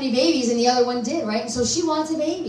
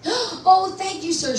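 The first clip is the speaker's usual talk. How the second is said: exclaiming; 7 semitones higher, slower than usual. Retold or imitated. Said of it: "she exclaimed".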